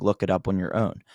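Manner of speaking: ordinary delivery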